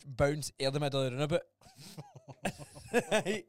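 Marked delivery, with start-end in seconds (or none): 1.6-3.1 a sudden high laugh; high burst of laughter. Real, not acted. Laughing at his own reckless driving story.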